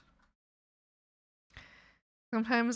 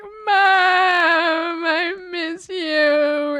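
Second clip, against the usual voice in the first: high, very slow whimpering plea; mock whimper; 9 semitones higher, 7 dB louder, much slower than usual. Calling her mother while upset, mock whimper. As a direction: whimper it like a homesick kid. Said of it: "I was like".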